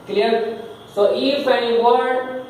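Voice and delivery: normal talking voice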